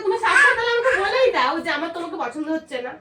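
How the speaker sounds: high, loud scolding; angry yelling. Acted. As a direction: scold him for laughing. Fake quarrel, fighting laughter.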